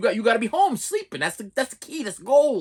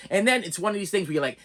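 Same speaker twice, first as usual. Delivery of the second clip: ordinary delivery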